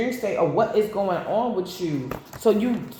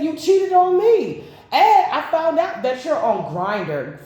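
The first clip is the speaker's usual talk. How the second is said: higher, louder, slow accusation; mock accusing; 7 semitones higher, 5 dB louder, slower than usual. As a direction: throw the betrayal back at him, slowly. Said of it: "She said".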